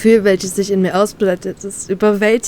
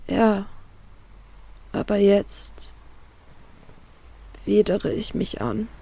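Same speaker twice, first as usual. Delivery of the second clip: much softer, slow, barely voiced; quietly; 9 dB quieter, slower than usual. Heroine confessing to Trafalgar, quiet and ashamed. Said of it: "beginne ich leise".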